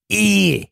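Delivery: "ear" high and long as EEE; demonstrated vowel. Shown as a demonstration. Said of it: "Like that".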